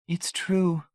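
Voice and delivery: wife insisting through tears; tearful. A narrator's reading. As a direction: say it crying. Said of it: "Tears rolled helplessly".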